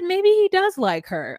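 delighted high sing-song hope; hopeful delight. Retold or imitated. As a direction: coo it like you're rooting for the couple. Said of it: "And I said".

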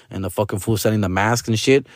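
usual speaking voice

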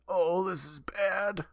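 strained stomach-ache voice; in pain. Retold or imitated. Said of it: "He's clutching his stomach saying".

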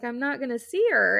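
usual speaking voice

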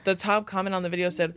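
usual speaking voice

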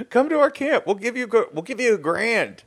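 high-pitched, hammed-up huckster offer; mock recruiting pitch. Coach pitching camp to recruits, mock sales voice. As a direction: sell it way up high, playfully.

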